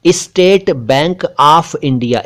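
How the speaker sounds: Bank of India spelled out very slowly; slow it right down, word by word; slow dictation. Shown as a demonstration. Teacher showing how the name is written in Urdu, slowly. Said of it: "हम उर्दू में लिखेंगे".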